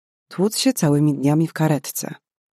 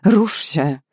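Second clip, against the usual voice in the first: raised, pleading cry; urgent plea; 4 semitones higher than usual. A narrator's reading. Karolina to the dying patient, urgent raised plea. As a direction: beg the heart to start. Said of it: "Błaga".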